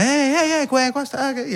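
high, fumbling mock Italian official; flustered Italian. Imitating a flustered official on the set. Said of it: "la clásica".

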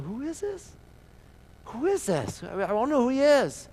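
high, puzzled bystander's question. Acted. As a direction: ask it like a confused bystander. Someone in the crowd is puzzled.

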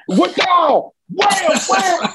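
high-pitched acted alarm, each word punched; startled mock yell. Wrestler reliving a belt whizzing past his face, startled yelps. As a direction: yelp it like you are dodging blows.

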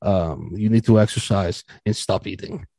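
low, soft imitation of a doctor's orders; blunt doctor. Doctor prescribing weight loss, blunt and low. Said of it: "Very, very blunt".

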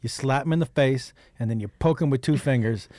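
ordinary delivery

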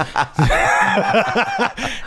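breaks into a high laugh; cracking up while telling the story; high burst of laughter. Real, not acted. Laughs mid retold advice.

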